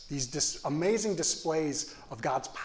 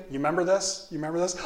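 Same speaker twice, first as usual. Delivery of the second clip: ordinary talk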